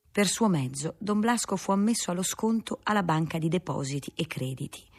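ordinary delivery, nothing marked

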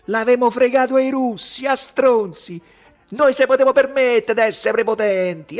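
high, loud, ranting oratory; shouted harangue. A narrator's reading. Ugo rants at the crowd about Rome, shouting. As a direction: rail at the whole square. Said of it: "gridò".

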